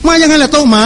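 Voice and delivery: no break between "โต๊ะ" and "หมา"; no pause. Retold or imitated. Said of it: "พ่อไม่เว้นวรรค".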